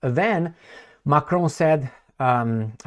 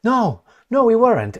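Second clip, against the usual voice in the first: mock denial; 9 semitones higher, 6 dB louder than usual. Flustered denial in retold quarrel.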